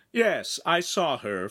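high, drawn-out smiling reply. A narrator's reading. Noble reassuring his panicked companion, smiling and unhurried. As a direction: answer slowly with an audible smile. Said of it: "Feltrino said, smiling".

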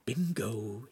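soft, pleased aside; to himself. Acted. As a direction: murmur it under your breath. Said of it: "he said to himself".

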